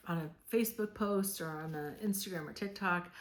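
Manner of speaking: ordinary delivery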